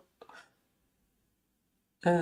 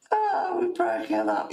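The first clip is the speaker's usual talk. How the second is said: laughing; 11 semitones higher than usual. Real, not acted. Laughing at chat.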